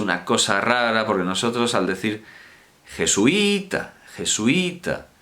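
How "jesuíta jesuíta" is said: "jesuita" with the i stressed as its own syllable; broken diphthong. Shown as a demonstration. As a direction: say "jesuita" as je-su-Í-ta, holding the í. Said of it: "estamos rompiendo el diptongo".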